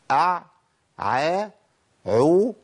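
أع، ع، عو as separate drilled syllables; model ayn forms. Shown as a demonstration. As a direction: give أع، ع، عو one at a time, ayn clear in each. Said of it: "يبقى إيه". (أع، ع، عو بنطق تعليمي منفصل)

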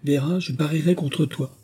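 high-pitched, eager challenge; lively young girl making a bet; girlish exclamation. A narrator's reading. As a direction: pipe up like an excited girl. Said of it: "s'écria la fillette".

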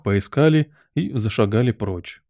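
usual speaking voice

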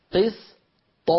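"قسط" with a light bounce on the final ط; qalqala on ط. Shown as a demonstration. Teacher isolates the word for students, echoing the ط. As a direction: say "قسط", releasing the ط with a small echo. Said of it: "كيف خرجت هذه الطاء المقلقلة".

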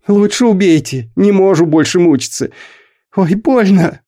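crying in pain. A narrator's reading. Stuck boy cries out to the boss, weeping plea. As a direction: sob out the pain from the pipe. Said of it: "В трубе заплакала".

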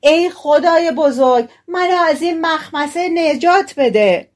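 high, loud plea to God; frightened shout for help; loud panicked cry. A narrator's reading. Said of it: "با صدای بلند فریاد زد".

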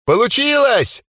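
high, delighted yell; elf yelling. Acted. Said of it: "орал эльф".

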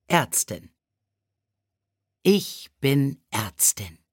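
ordinary delivery, nothing marked

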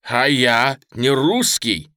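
slow, emphatic angry challenge; indignant fury. Acted. Lieutenant challenges the merchant's rebuke, furious and indignant. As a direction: throw the question back, offended. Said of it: "Поручик в ярости".

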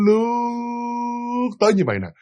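LƯỚT stretched long and high; drawn-out glide. Shown as a demonstration. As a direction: hold "lướt" in one long, eerie glide. Shows how the ghost glided.

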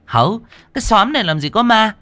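put-on high, bossy old landlady scolding. Landlady Thuận dismissing the tenants' ghost talk, scolding. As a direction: brush off the fuss, dismissive.

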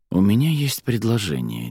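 ordinary delivery, nothing marked

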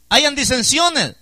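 «hayan disensiones» slowly, high and loud; emphatic scripture reading. Real, not acted. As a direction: stretch «hayan disensiones» out and hit it hard.

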